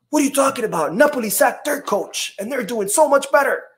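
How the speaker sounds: high-pitched incredulous protest, imitating a critic; mock indignant commenter.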